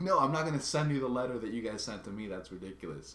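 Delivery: exasperated retort. Retold or imitated. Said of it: "really illogical".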